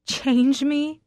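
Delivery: bitter laugh. Acted. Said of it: "I laugh bitterly".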